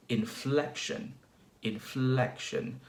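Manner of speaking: slow "inflection", leaning on "flec"; stress on FLEC. Shown as a demonstration. Teacher demonstrating English inflection, stress on "flec" in "inflection". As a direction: draw out the second syllable of "inflection". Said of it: "a stress on the second syllable".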